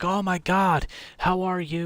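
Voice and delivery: high, bright, overjoyed hello; excited greeting. Retold or imitated. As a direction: greet an old regular with delight. Shop guys greet Shaggins excitedly.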